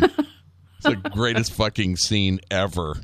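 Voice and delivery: ordinary delivery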